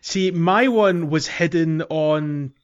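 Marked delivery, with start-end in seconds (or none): none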